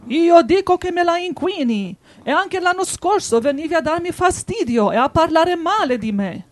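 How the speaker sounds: high, loud menacing wolf. A narrator's reading. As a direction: accuse him like a bullying wolf. Wolf accuses the lamb.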